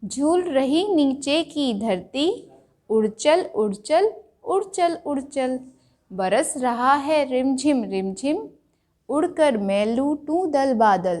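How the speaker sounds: put-on sing-song reading of a children's poem; poem recitation. Shown as a demonstration. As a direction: recite it rhythmically for the class. (बच्चों की कविता का लयबद्ध पाठ)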